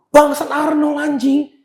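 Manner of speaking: high, loud disbelieving curse; starstruck outburst. Acted. Gym-goer spotting Arnold outside, starstruck outburst. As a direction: blurt it out in a high, shocked voice.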